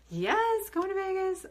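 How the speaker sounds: very high, cheering yes; giddy vacation cheer; excited about Vegas. Real, not acted. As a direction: squeal about the trip. Thrilled about vacation tomorrow.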